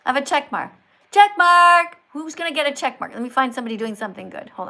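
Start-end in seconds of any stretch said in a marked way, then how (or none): 1.1-2.0 loud, drawn-out call. Real, not acted. Streamer calling for someone to give a check mark, loud. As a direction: holler it out to the crowd.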